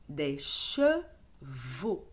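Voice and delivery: chevaux drawn out slowly; slow model reading. Shown as a demonstration. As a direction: read des chevaux slowly for a child. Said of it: "models word slowly". (lecture lente de des chevaux)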